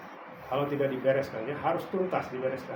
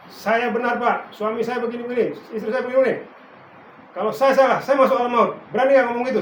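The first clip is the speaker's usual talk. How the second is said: louder, higher insisting voice; defiant spouse; 6 semitones higher, 8 dB louder than usual. Retold or imitated. Spouse defends herself to the pastor, loud and insistent. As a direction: play a spouse loudly insisting they are right.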